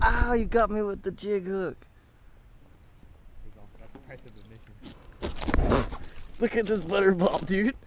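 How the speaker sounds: loud high thrilled voice; excited. Real, not acted. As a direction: shout it out excited. Hooked and thrilled.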